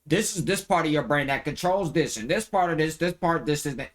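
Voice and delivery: dull teacher rattling off. Retold or imitated. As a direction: rattle off like a bored biology teacher. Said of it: "They said".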